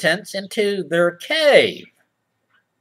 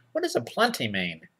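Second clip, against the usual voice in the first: ordinary delivery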